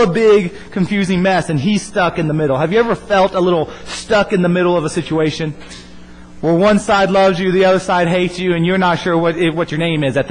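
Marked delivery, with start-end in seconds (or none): none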